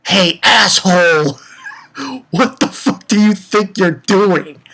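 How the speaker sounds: slow, loud, high mock snarl; angry villain yell. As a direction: snarl it at him slowly, like a thug. Villain Ogata yelling at Tanaka.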